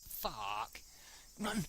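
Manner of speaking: plain reading voice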